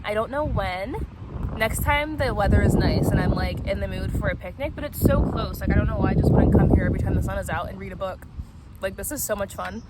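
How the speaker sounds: usual speaking voice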